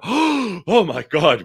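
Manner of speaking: high, slow gasped oh my God; mock horrified gasp. Mock reaction to an ugly child walking in, gasped and high. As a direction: gasp as if seeing an ugly baby. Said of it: "you can't go".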